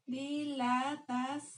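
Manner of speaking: drawn out syllable by syllable, Dilatación; slowly spelling out Dilatación for notes; slow dictation. Shown as a demonstration. Teacher dictating the new word to students, drawn out slowly. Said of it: "con acento en la O".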